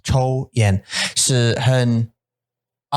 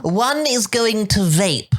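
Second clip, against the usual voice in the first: mock formal; 10 semitones higher, slower than usual. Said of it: "mock formal verb demo".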